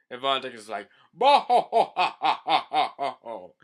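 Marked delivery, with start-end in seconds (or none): 1.1-3.6 villain laugh. Acted. Von Decken gloating with a loud odd laugh. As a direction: give a weird gloating laugh. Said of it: "I just love their laughs".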